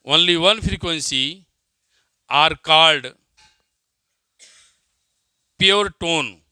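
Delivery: slow, deliberate dictation pace. Acted. Teacher dictating a definition to students, slow dictation. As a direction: spell it out word by word for note-taking.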